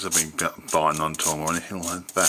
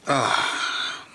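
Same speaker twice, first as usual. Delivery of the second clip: sniffling. Real, not acted. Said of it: "excuse my sniffling".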